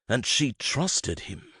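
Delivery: usual speaking voice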